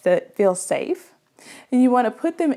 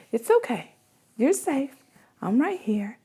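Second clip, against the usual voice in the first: soothing; 3 dB quieter than usual. Shown as a demonstration. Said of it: "I'm just rubbing their back and I'm saying".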